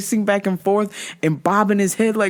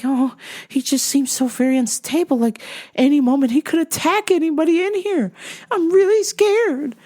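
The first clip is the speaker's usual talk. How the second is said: put-on old lady voice; 6 semitones higher than usual. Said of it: "She's like".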